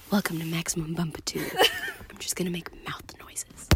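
soft, low, whispery welcome with a laugh in it; mock ASMR. Podcaster greeting listeners to pretend ASMR episode, soft whispery mock ASMR. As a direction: murmur it close like an ASMR parody. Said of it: "this is our, um, A S M R episode".

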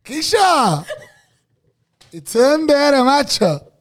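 loud, high laughing yell; excited shout. Real, not acted. Hyped friend yelling across the room, loud and laughing. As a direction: shout it out to hype your friend.

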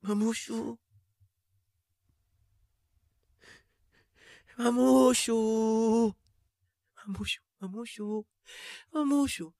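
soft, high, drawn-out calls; faint calling out. Acted. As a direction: whimper for mummy, high and soft.

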